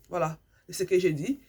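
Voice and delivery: ordinary talk, nothing marked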